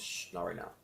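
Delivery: soft, gentle hush to a passing thought; gentle shush. Shown as a demonstration. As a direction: shush your own thought kindly and softly. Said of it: "Internally say".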